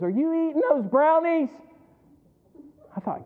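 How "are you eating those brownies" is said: high, loud startled accusation; wife catching him. Retold or imitated. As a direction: catch him red-handed in the kitchen. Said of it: "Emily goes".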